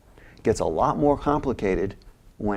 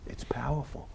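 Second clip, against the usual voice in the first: whispered again, softer; hushed awe; 3 semitones higher, 11 dB quieter than usual. Real, not acted. Host repeating the thought to the listener in a whisper. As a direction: let it land in a whisper. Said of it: "awe after the story".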